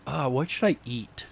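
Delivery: ordinary delivery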